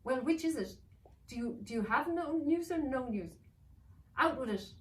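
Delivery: villain demanding answer from servant; impatient villain. Acted. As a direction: snap at the servant.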